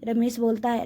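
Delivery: ordinary delivery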